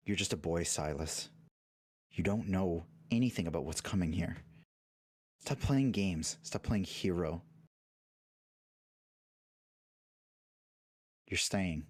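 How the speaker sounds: lightly voiced stern father; a hint of a cold, low father. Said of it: "your voice cracks... your father".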